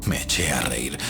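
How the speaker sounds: ordinary talk, nothing marked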